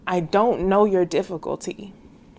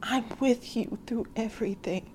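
much softer, tender reply in God's voice; God's gentle answer; 3 semitones higher, 10 dB quieter than usual. Retold or imitated. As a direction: answer softly, like God comforting her. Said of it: "And God is like".

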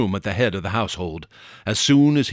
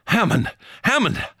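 high, desperate calls, second one higher; despairing cry; 9 semitones higher than usual. A narrator's reading. Harry desperately calls Hammond, despairing cry. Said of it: "I cried again, despairingly".

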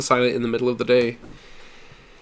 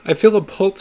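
higher, louder cry of relief; cried out; 7 semitones higher, 4 dB louder than usual. A narrator's reading. Father announcing his son's pulse, crying out. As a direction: call out the news. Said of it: "he cried".

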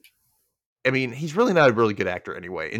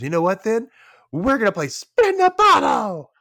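higher, defiant retold outburst; mock mad; 10 semitones higher, 4 dB louder than usual. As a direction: announce it loudly like an indignant drunk. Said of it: "And she gets mad".

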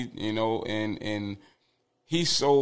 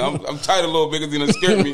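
normal talking voice

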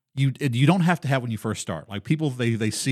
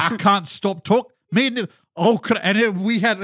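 put-on Scottish accent; 8 semitones higher, 4 dB louder than usual. Stuck in the accent with a friend, Scottish accent. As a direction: wail it in a Scottish brogue. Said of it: "we were stuck in that accent".

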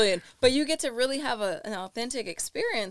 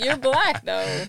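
slow mock voice; 3 semitones higher, 5 dB louder, much slower than usual. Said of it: "I'm like".

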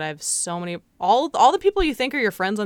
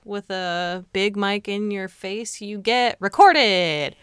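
slow ominous; slower than usual. Pretend. Mock ominous warning.

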